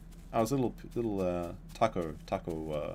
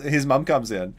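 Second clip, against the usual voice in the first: ordinary talk, nothing marked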